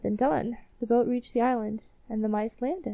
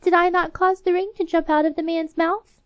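indignant mouse; 9 semitones higher, 6 dB louder than usual. Acted. Mouse quarrel continues.

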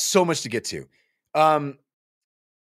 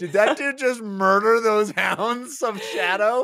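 slow, higher, laughing disbelief; awed laughing gasp of a question; stunned disbelief; 7 semitones higher, slower than usual. Retold or imitated. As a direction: gape at what you just saw, half laughing. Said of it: "just like".

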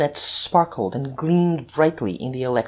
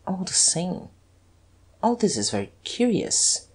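hushed, higher challenge; level a soft, suspicious accusation; understated menace, kept quiet; quietly accusing; 4 semitones higher, 4 dB quieter than usual. A narrator's reading. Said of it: "a challenging, menacing, accusing tone".

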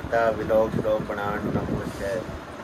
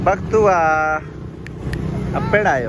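calling out; 5 semitones higher, slower than usual. Real, not acted. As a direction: call to someone just arriving. Calling to the arriving Bakhtu.

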